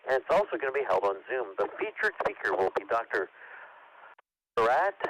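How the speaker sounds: usual speaking voice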